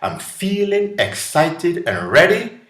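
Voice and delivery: quoted with a bright high lift, thrilled; excited. Retold or imitated. Someone imagining a new word for fear, excited. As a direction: sound thrilled and ready to go. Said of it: "But if somebody said".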